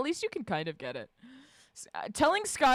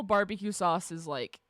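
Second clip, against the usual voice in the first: ordinary talk, nothing marked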